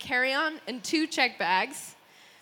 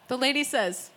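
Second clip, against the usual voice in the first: ordinary talk, nothing marked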